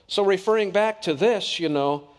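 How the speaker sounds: ordinary talk, nothing marked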